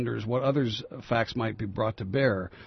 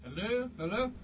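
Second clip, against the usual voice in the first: high calls from across the room; yelling across the room; 11 semitones higher, 7 dB quieter than usual. Real, not acted. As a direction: shout from a distance, off mic. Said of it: "I'm yelling across the room".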